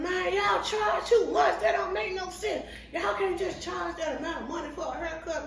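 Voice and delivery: mock the customer storming out; retold screaming. Customer yelling at the barbers while leaving, imitated yell.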